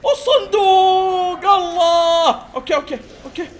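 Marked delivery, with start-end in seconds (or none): 0.1-2.4 loud, high, slow-drawn call; thrilled at finding the box; excited. Real, not acted. Spots the mystery box. (نداء متحمس عالٍ وممدود)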